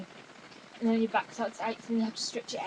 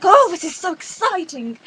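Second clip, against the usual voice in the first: high, loud imitation of excitement; overplayed retold thrill; mock excited squeal; 7 semitones higher, 13 dB louder than usual. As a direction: gush it high and loud, hammed up. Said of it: "and I was like".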